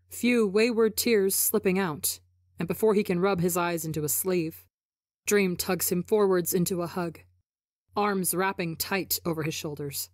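plain reading voice

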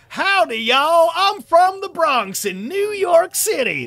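very high, loud, drawn-out mock Southern accent; bad Southern accent. Shown as a demonstration. Imagining a misplaced Bronx native, terrible loud Southern accent. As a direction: holler a hammed-up, terrible Southern drawl. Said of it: "my bad attempt at a Southern accent".

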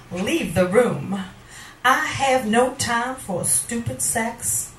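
normal talking voice

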